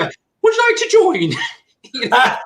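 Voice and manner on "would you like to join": very high, squeaky nervous ask; sheepish squeak. Retold or imitated. Nervous recruit pitch to the homeowner, very high squeak. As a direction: squeak out the question in panic.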